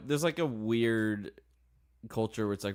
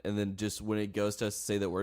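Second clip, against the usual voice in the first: ordinary talk, nothing marked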